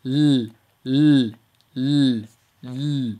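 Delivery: long light "l", tongue up at the palate; Arabic lam sound. Shown as a demonstration. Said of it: "so we should sound like l".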